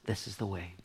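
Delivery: soft, hushed guidance; voice of God. Retold or imitated. God guiding the reader of his word, hushed. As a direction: murmur it low and soft. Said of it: "God says".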